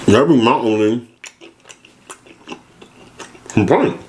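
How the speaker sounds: chewing while talking; speaking between bites; mouth full of pizza. Real, not acted. Seen 1: bites pizza at -4s, lips pursed and chewing through labelled words.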